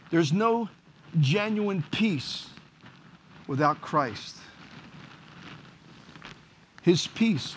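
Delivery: slow, solemn preaching with long pauses. Acted. Pastor urging his congregation toward Christ, slow and solemn. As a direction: let each phrase land, slowly.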